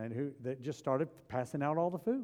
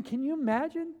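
ordinary delivery

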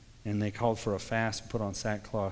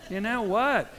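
exasperated complaint to God; 8 semitones higher, 4 dB louder, much slower than usual. Retold or imitated.

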